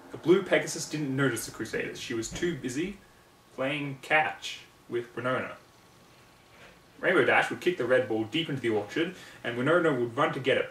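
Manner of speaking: ordinary delivery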